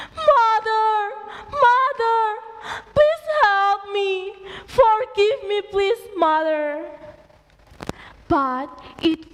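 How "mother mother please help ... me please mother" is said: high, loud, slow sobbing plea; crying for help. Acted. Son in the storm begs his mother, loud crying. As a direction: beg your mother for forgiveness. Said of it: "Malin cried loudly".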